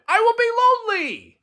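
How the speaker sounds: very high, loud wail; desperate shout. Acted. Toad fears losing Frog, high wailing shout. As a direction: wail it out, pleading. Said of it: "he shouted".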